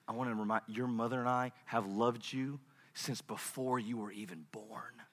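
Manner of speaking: hushed and low, fading at the end; tender fatherly love. Retold or imitated. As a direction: gently remind a child he is loved. Father tells son of love.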